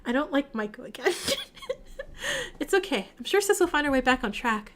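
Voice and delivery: usual speaking voice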